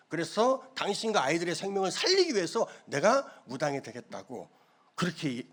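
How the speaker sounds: ordinary talk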